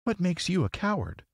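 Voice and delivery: put-on high-pitched, wondering child; high girlish question; little girl. A narrator's reading. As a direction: ask in a high girl's voice. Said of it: "asked Dorothy".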